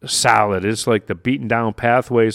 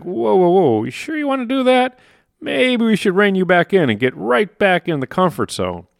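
higher, anxious mock alarm; alarmed inner voice; 10 semitones higher than usual. Inner mechanism reining in a would-be entrepreneur, high mock alarm. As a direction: voice the brain panicking as you stretch out. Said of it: "and it's like".

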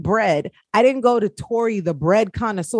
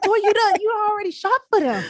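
high, incredulous mock scolding; half-joking high scold at a shopaholic friend; exasperated scolding; 11 semitones higher than usual. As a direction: scold her like she's lost it. Mock scolding friend over shopping.

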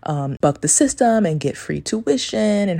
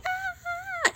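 very high, soft stifled scream; mock scream; 9 dB quieter than usual. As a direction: squeal a fake scream quietly. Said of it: "And would be screaming".

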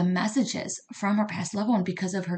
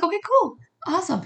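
much higher and louder, breezy okay cool; squeaky upbeat brush-off, much higher; chipper acceptance; 12 semitones higher, 4 dB louder than usual. Retold or imitated. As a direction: play it cheerfully unbothered by bad news. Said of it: "I was like".

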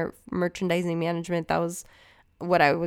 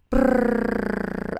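trilled brrr; 7 semitones higher than usual. Pretend. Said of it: "I don't know what that was".

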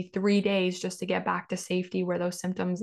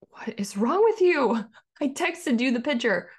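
sharp irritated outburst, a bit louder and higher; snapping; 5 semitones higher, 4 dB louder than usual. Retold or imitated. Wife snapping at husband over wrong toothpaste, angry. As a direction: snap at the husband like you've lost it. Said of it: "And you snap".

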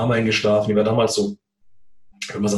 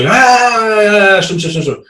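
hasty fobbing off; 8 semitones higher, 6 dB louder than usual. Retold or imitated. Fobbing off wife, pretending to remember.

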